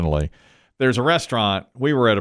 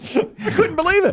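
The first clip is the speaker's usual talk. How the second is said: laughing. Real, not acted. Laughing at the story.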